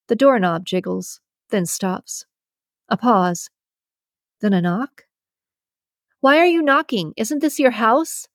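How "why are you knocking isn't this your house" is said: raised, higher voice, faking courage; putting on a brave front, calling through the door; brave shout. Acted. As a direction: shout it to sound braver than you feel. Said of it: "I shout with the bravest voice I can muster".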